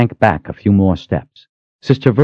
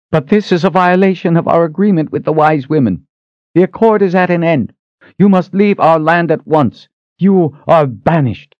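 angry queen decree; 11 semitones higher, 4 dB louder than usual. A narrator's reading. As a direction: pronounce the banishment with cold anger.

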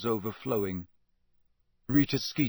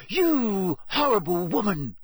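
slow, higher, through gritted teeth; 8 semitones higher, 4 dB louder, slower than usual. Acted. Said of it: "she said through gritted teeth".

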